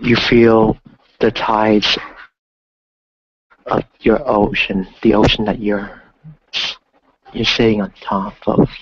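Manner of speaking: slow, calm meditation voice; guided meditation. Acted. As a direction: guide them gently through the breath. Guided ocean visualization.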